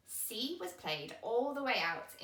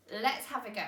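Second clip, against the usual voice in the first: ordinary delivery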